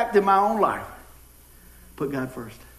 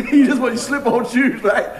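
loud, high, laughing through the words; 6 semitones higher, 8 dB louder than usual. Real, not acted. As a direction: crack up at your own joke. Cracks up at own joke.

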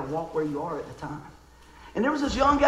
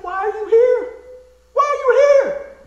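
high, loud mock disbelief, stretched on the repeat; retold incredulous yell; 12 dB louder, slower than usual. Young man spots his friend walking into the club, retold incredulous yell. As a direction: yelp it in hammed-up shock, far above normal pitch. Retells his startled challenge to visitor.

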